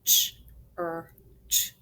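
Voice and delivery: church broken into separate sounds "Ch, er, ch"; finger-spelling by sound, a gap after each. Shown as a demonstration. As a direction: say "Ch", "er", "ch" as isolated sounds. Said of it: "Let's finger spell".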